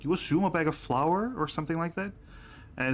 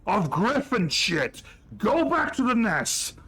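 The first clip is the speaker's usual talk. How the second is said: higher, louder scolding rant; angry dwarf chef; 8 semitones higher, 3 dB louder than usual. Acted. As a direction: chase the smelly intruder out. Chef scolding the griffin handler.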